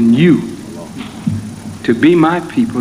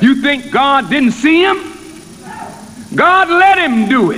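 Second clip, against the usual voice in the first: higher, ringing preacher's voice building to a peak; full-force preaching; 10 semitones higher than usual. Acted. Minister voicing God's plan to the congregation, rising preaching.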